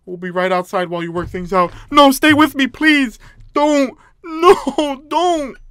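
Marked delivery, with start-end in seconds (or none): 1.9-5.6 loud, higher whining plea; desperate pleading. Real, not acted. Player begging the others to stay, desperate pleading. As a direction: beg them in a raised cry.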